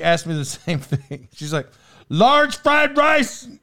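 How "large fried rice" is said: high, slow put-on Chinese-accented waitress, questioning. Imitating the curt waitress asking for his order, high and slow in a mock Chinese accent. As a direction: drag out each word in a mock Chinese accent, pitched up high. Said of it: "I can't do the accent".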